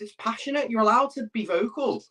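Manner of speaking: ordinary delivery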